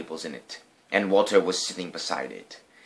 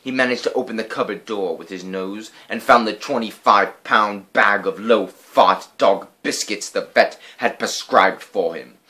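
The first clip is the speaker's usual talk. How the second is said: ordinary delivery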